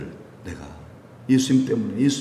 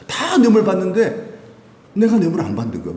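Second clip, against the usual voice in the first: normal talking voice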